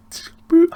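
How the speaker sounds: high loud boop for a hang-up; boop sound. Retold or imitated. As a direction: pop the word like a phone cutting off. Phone hung up abruptly.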